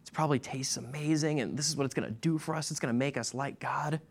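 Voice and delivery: a hushed, sly sales pitch held out like the fruit; Eve tempting Adam. Acted. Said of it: "and said".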